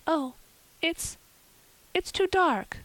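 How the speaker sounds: put-on high, halting, alarmed girl; dismayed cry. A narrator's reading. Anne balking at an evening errand, crying out in dismay. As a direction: stammer out a high-pitched excuse. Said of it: "cried Anne".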